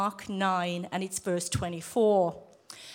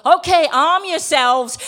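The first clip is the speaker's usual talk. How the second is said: loud, higher, drawn-out rallying call; mock preacher yell; 7 semitones higher, 13 dB louder, slower than usual. Bishop rallying his ministry class as time runs out, loud imitation. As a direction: belt it out like a fired-up bishop. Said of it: "he'd look at the clock and he'd go".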